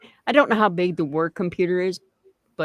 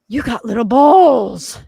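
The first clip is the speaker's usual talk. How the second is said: excited; 4 semitones higher, 10 dB louder than usual. Real, not acted. Excited reaction to pudding.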